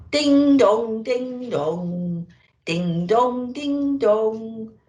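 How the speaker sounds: sing-song ding dong like church bells; lilting imitation of church bells; bell chimes. Bells ringing again.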